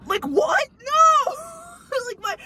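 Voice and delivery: high laughing cry of disbelief; laughing shout. Real, not acted. Friends recalling the night, shrieking with laughter.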